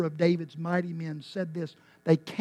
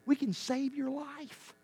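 soft promise; 7 semitones higher, 8 dB quieter than usual. Acted. Doctor's promise said twice.

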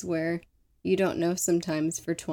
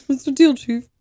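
cocky mock-innocent employee; 7 semitones higher, 7 dB louder, faster than usual. Plays Wiley answering the chief.

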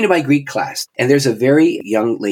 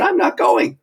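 high exclaimed refusal. Retold or imitated. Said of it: "I said".

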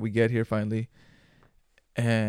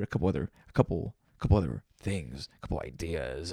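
mock deep; 6 semitones lower than usual. Voice drops mid-sentence.

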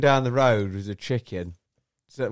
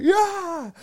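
high chicken whoop; high triumphant chicken yell; chicken cheer; 4 dB louder than usual. Pretend. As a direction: gloat like a cocky chicken. Chicken gloating to mates.

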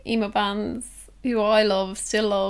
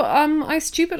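normal talking voice

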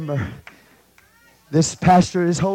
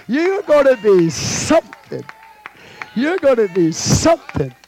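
higher, louder, slower preacher cry; fired-up preacher promise; preaching at full force; 8 semitones higher, 5 dB louder than usual. Acted. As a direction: stretch it out like a rising sermon climax.

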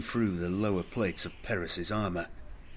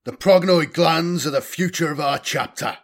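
loud, raised lecturing bark; 9 semitones higher, 9 dB louder than usual. A narrator's reading. Senior apothecary instructing in the apothecarian, barking. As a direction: bark it out to fill the room. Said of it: "Apothecary Loris's barking tone".

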